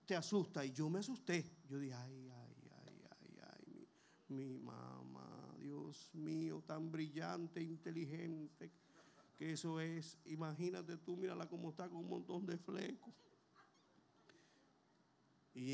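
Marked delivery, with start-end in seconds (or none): 1.9-13.1 hushed lament. Retold or imitated. Retold shock, lamenting mother.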